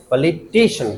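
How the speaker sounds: "Politician" with टि stressed; stress demo. Shown as a demonstration. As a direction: lean firmly on टि in "politician". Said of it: "क्या बोलेंगे?". ("Politician" में टि पर ज़ोर)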